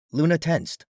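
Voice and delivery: ordinary delivery, nothing marked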